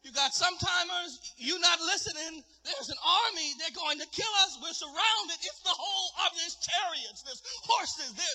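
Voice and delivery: high, thin frantic voice; panicking servant. Acted. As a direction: pile up the alarms in acted panic. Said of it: "He's, he's panicking".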